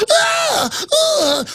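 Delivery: very high falsetto shriek; hammed-up high scream; mock shriek. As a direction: squeal like a frightened woman. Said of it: "And the women go".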